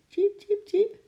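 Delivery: three very high cheeps; high-pitched animal chirping; squirrel chirp. A narrator's reading. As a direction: peep like a tiny squirrel. Little squirrel chirps to Eekie.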